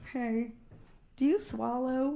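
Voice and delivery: soft, low, tender asking; really sweetly. Retold or imitated. Lovely guy asking her, really sweetly. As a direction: ask it gently, like a shy sweet lover. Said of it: "asked me really sweetly".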